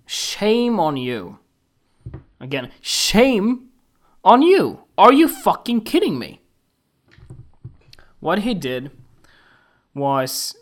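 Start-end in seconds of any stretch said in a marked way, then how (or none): 0.1-4.8 starts slow, climbs to a loud, high yell; building outraged shout. Real, not acted. Scolding the photographer over the shoot, slow then yelling. 5.0-8.5 loud, high, incredulous yell; outraged yell. Real, not acted. Yelling at the photographer in disbelief, loud and high.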